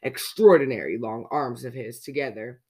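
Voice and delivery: ordinary delivery, nothing marked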